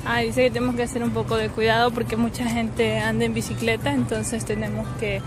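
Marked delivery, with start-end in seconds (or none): none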